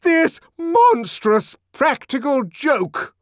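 high, slow outraged shout. A narrator's reading. Director struggling amid howls of laughter, shouting. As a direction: bellow each word over the laughter. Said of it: "the director shouted".

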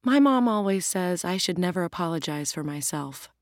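plain reading voice

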